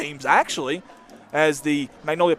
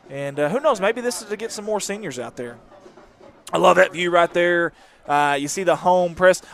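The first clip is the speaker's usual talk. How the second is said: ordinary talk, nothing marked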